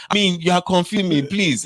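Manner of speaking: high-pitched imitation of pleading; mock begging. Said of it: "he's begging".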